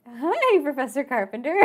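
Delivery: high, loud, guilty hello; caught-out greeting. Retold or imitated. Caught in the lab, greeting the professor with a guilty chirp. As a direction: chirp it like you've been caught.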